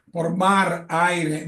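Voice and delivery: ordinary talk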